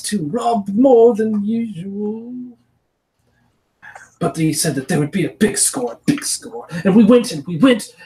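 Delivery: high, halting goblin voice; a scared goblin stumbling through its tale; broken goblin story. Acted. Goblin telling the party, high and broken. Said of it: "the goblin will launch into this kind of broken story".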